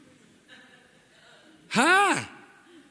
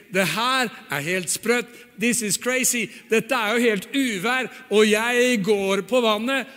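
Peter's panicked thought; 6 semitones higher, 4 dB louder, slower than usual. Acted. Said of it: "han tenker".

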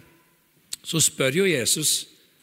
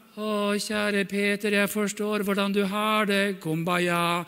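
mock tender Jesus; 5 semitones higher, slower than usual. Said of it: "han sier ikke da liksom".

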